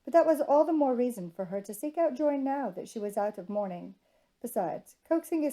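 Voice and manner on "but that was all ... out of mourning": ordinary delivery, nothing marked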